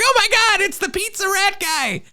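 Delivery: high squealing tourist gasp; mock starstruck tourist squeal; excited tourist.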